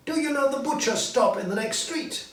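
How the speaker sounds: put-on high, lively old miser turned joyful; high, eager old man calling a boy; cheerful old Scrooge. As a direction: play Scrooge bursting with glee. Narrator voicing Scrooge.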